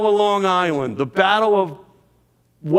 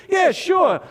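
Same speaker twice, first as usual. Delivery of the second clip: mocking New York yell, pitched way up; New York accent; 8 semitones higher than usual. New York Historical Society guy scoffing, in a New York accent. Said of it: "with that great New York accent".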